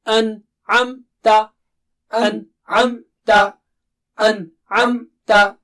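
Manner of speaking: An-amta, slow with a shorter gap; slowly joining the syllables; syllables closer. Shown as a demonstration. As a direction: bring the parts of An-amta nearer. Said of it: "Bring them a little closer".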